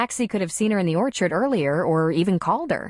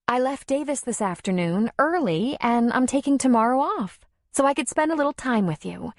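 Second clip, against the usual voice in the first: a hint of a higher, sweeter woman's voice; Darla, lightly voiced; 6 semitones higher than usual. A narrator's reading. Darla greeting Tom in his office, lightly voiced. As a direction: lightly play the girlfriend.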